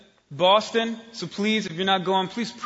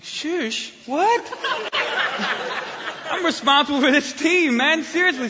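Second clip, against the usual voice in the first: much higher, laughing shush what; laughing disbelief; 8 semitones higher than usual. Retold or imitated. Pastor answering the guide's shush, higher and laughing. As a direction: squeal it through laughter, appalled.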